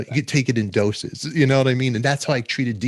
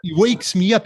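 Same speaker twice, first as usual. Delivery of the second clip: ordinary delivery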